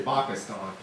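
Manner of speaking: "Pakistan" as "bah-ki-stahn", P almost a B; soft P. Shown as a demonstration. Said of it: "It's almost like a B".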